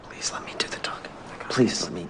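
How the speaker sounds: pleading whisper to a partner; hushed aside. Acted. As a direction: hush it so the customer won't hear. Aside to partner before customer.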